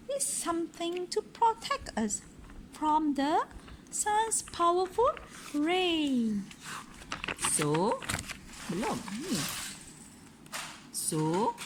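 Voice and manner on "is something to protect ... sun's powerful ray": high, bright lady's voice; Missus Armitage voice. A narrator's reading. Parent reading aloud, voicing Missus Armitage, high and prim. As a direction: lift the voice up for the lady. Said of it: "said Missus Armitage".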